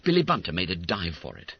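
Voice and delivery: plain reading voice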